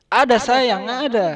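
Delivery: high, loud, cooing ada sayang; sweet reply. Retold or imitated. Voice behind the door answering sweetly, high and loud. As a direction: coo it like an endearment. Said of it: "Terus dia bilang".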